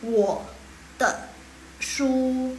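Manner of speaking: "Wo te shu" each tone traced clearly; tones with head movement. Shown as a demonstration. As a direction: follow "Wo te shu" with your head. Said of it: "Kepalanya juga ikut". ("Wo te shu" pakai bantuan kepala)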